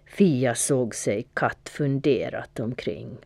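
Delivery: usual speaking voice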